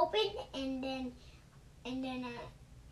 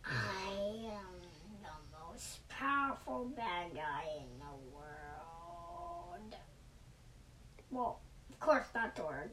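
evil bad guy voice; 7 semitones lower, 6 dB quieter than usual. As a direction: rumble out the villain's brag.

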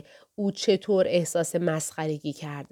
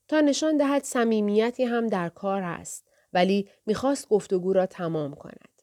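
usual speaking voice

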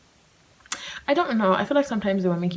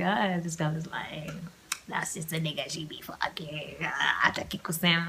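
hushed judging friends; 2 semitones lower, 6 dB quieter than usual. Retold or imitated. Voicing friends judging her.

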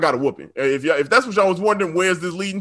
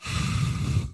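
heavy breath; 8 dB quieter than usual. Retold or imitated. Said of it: "She was like".

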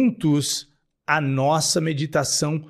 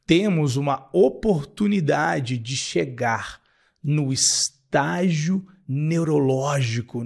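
ordinary delivery

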